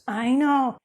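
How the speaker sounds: bright, excited exclamation. Real, not acted. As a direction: blurt it out with real excitement. Host's excited aside about program.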